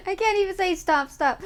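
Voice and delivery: ordinary talk, nothing marked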